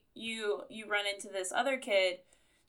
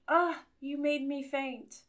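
swooning damsel; 5 semitones higher, slower than usual. Acted. Quoting a swooning line from the book.

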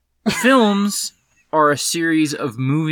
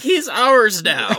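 slow, high shouted claim; triumphant shout; 6 semitones higher than usual. Retold or imitated. Mock claiming the son.

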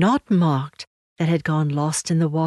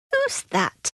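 high, small startled voice; little frightened voice. Acted. Little creature answering a knock at the door, frightened and high. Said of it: "A little frightened voice called out".

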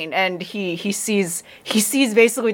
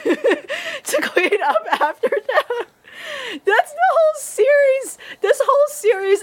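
laughing; 13 semitones higher than usual. Real, not acted. Laughs at own punchline.

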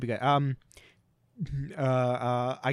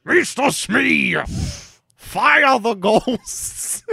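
higher, louder, slow pompous pirate captain; Captain Hook; 8 semitones higher, 8 dB louder, much slower than usual. Acted. Captain Hook ordering Mister Smee around, slow and pompous. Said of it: "that's Captain Hook".

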